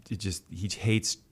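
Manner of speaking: ordinary delivery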